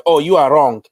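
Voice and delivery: normal talking voice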